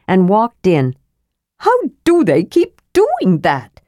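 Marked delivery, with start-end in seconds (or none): 1.6-3.8 high, slow, swooping exasperation; exasperated high-voiced little fellow; frustrated demand. A narrator's reading. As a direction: demand an answer in sheer frustration.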